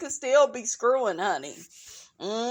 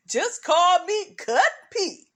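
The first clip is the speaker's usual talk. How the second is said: laughing joke; 4 semitones higher, 3 dB louder than usual. Real, not acted. Joking movie quote.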